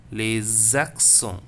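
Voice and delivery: very slow "Les accents", Z joining the two words; stretched liaison. Shown as a demonstration. Teacher demonstrates the Z sound, slow linked syllables. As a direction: draw out lay-zak-son, last T silent. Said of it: "join with the A to give the".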